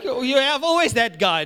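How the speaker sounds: ordinary talk, nothing marked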